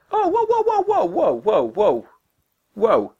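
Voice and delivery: very high, squealing run of whoas that falls back down; a cartoonish yelp of alarm, descending; mock alarm. Reacting to Ash's comment.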